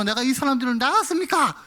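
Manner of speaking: a hint of Moses sulking at God; sulky complaint. Moses protesting to God, mock sulky complaint. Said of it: "볼멘 소리로".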